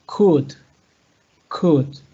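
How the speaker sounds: "could" said as kud, no L sound; could without L. Shown as a demonstration. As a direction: drop the L in "could". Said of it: "delete the pronunciation of the L".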